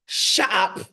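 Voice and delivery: British disbelief building to a big shout; elated British accent. Retold or imitated. Idris learning she's from Ghana, jubilant British accent. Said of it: "he looks at me and he goes".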